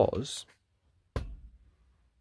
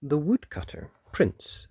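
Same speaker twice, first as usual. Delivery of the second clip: plain reading voice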